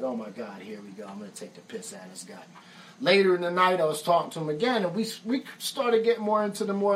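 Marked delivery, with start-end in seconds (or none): 0.0-2.4 soft, fast mock muttering to himself; quietly gleeful, under his breath; muttered aside. Narrator plotting to tease the British singer, muttered softly. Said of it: "I was like".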